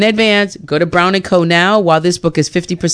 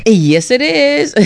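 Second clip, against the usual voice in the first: laughing; 7 semitones higher, much slower than usual. Real, not acted. Laughing reply to ad.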